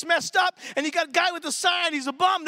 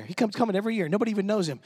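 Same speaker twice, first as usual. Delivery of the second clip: ordinary talk, nothing marked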